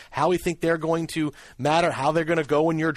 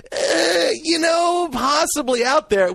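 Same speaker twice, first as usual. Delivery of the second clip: mock whiny hedging; 12 semitones higher, 5 dB louder, slower than usual. Mocking vague draft talk.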